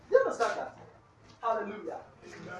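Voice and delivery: ordinary delivery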